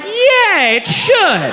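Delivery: high-pitched indignant realization; shrill-pitched mock outrage; indignant agreement. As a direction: agree as if it's a revelation, voice way up.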